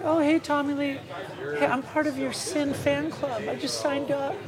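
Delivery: put-on very high-pitched young boy; squeaky little-kid voice. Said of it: "That's how I talked".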